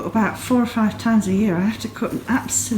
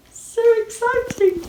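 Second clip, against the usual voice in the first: excited; 4 dB louder than usual. Real, not acted. Showing off her first minis.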